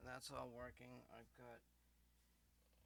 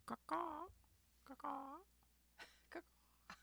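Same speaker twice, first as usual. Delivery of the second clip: crow caw; 11 dB louder, slower than usual. Pretend. Crow calls while fixing stream.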